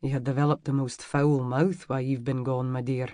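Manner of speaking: lightly voiced Scottish reproach; Scottish man scolding. A narrator's reading.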